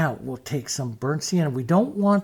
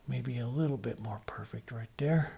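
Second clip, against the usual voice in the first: softer, whispered to himself; 3 semitones lower, 8 dB quieter than usual. Real, not acted. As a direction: breathe it out under your breath while painting. Painter murmuring while stroking collar.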